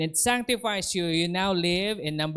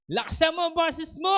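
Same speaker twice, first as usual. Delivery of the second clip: startling shout; 13 semitones higher than usual. Retold or imitated. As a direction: blast it at him to startle him. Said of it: "Nagulat ako".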